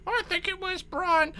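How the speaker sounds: crowd muttering. Acted. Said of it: "sort of general muttering going on".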